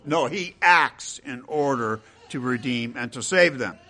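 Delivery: ordinary delivery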